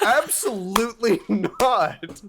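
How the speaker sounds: high, loud, slow mock-indignant no; emphatic refusal. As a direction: refuse it flatly and loudly. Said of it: "And I'm like".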